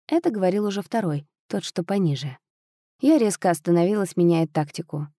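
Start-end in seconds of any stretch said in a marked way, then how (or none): none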